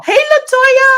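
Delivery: high, loud shouted greeting; big, high-pitched hello; excited calling out. Real, not acted. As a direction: holler out to a friend who just arrived. Calls out greeting to arriving friend.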